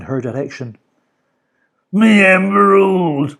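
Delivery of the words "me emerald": slow, loud villain moan. A narrator's reading. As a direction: groan it out. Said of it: "moaned Mister Gum".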